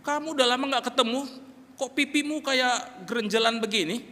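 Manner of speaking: godaan ibu, nada lebih tinggi; ibu bercanda. Retold or imitated. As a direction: sedikit menirukan ibu yang menggoda. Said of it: "Seorang ibu pernah bercanda". (ibu menggoda remaja putri dengan ringan)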